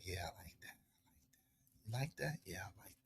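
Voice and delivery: barely audible, whispered pillow talk; imitated woman's whisper. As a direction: whisper it low, as her. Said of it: "She like".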